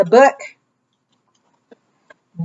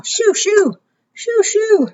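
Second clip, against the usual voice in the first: shooing; 13 semitones higher than usual. Shown as a demonstration. Shooing the snail playfully.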